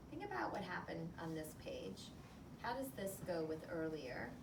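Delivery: ordinary delivery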